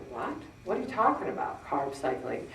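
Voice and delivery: baffled mutter. Retold or imitated. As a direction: mutter in confusion. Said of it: "And I'm like".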